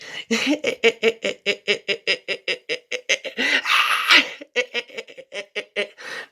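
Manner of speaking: long, high maniacal cackle; evil cackle. Acted. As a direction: cackle like Chucky. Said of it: "try to imitate my laugh of infinity".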